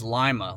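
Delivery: Lima said LYE-muh; local pronunciation. Shown as a demonstration. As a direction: say Lima with a long "eye" vowel. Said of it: "not pronounced Lima".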